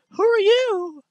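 put-on squeaky young girl reading her dialogue; girly game character. Voices Lily again.

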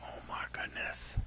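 breathy hushed gasp of wonder; a real whispered oh my goodness; awed whisper. Real, not acted. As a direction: whisper it, struck by the view. Awed at the view in game.

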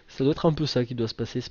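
usual speaking voice

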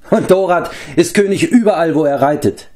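high, swaggering boast; lofty self-satisfied retort; boastful, amused. A narrator's reading. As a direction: brag grandly as a cocky gang leader. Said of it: "erwiderte der Mann mit den gelben Haaren und lachte".

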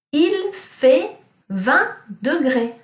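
teacher quizzing class with Il fait vingt degrés; slow model sentence. Shown as a demonstration. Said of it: "what does it mean if I say".

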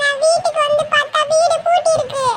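put-on very high, whimpering kid voice; high childish crying voice; tearful child. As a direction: complain tearfully to mum like a little child. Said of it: "ஏன் அழுவுறீங்க?".

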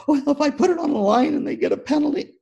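high-pitched fretting, mock panic; frantic worried dad. Band dad fretting to the helper, frantic high voice. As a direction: whine the worry in a squeaky fluster. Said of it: "just running around out of control".

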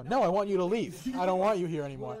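heated mock telling-off; retold angry dismissal. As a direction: order them off in a re-enacted row. Retold sending the cop away.

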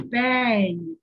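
one long, loud boom of a word; different, bigger bang. A narrator's reading. Narrator voices the door breaking, one big bang. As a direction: let a single bang ring out heavily. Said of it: "This bang was different. It was louder".

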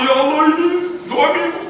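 imitated tearful cry. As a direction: cry the prayer out like a sobbing wife. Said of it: "isteri kalau tak teriak".

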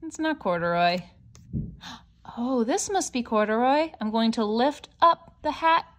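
ordinary delivery

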